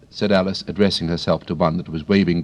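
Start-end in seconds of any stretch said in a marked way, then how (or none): none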